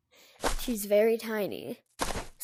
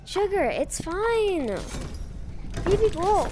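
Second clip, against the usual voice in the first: higher, slow soothing words for the dog; 5 semitones higher, 3 dB louder than usual. Real, not acted. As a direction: coax the puppy gently.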